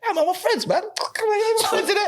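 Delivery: high, sing-song playing innocent; mock innocent. Telling bouncers it's nothing, in a high innocent voice. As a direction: act all friendly to fool the bouncers. Placating bouncers in retold scene.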